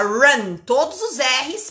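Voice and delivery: ordinary talk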